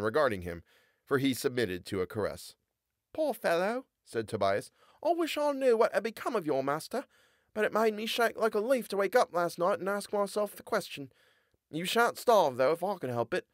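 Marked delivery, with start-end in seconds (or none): 3.1-13.5 Tobias, tender to dog. A narrator's reading. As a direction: comfort the frightened dog gently.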